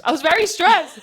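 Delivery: high loud stressed cry; stressed outburst. Real, not acted. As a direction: blurt out how stressed you were. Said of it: "I was stressed".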